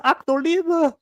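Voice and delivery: high mock German exclamation. Friend imitating a streamer turning German, mock German. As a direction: toss off a fake German phrase. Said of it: "She'll be like".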